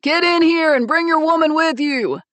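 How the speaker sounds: big booming call, high and loud; bellowing. Acted. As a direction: shout it hearty from the doorway. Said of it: "Pete bellowed".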